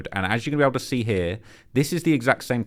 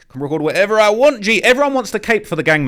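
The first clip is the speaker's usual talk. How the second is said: loud, high-pitched indignation; real exasperated outburst; indignant rant; 10 semitones higher, 8 dB louder than usual. Real, not acted. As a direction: protest hotly, voice raised. Ranting at man who objected to filming.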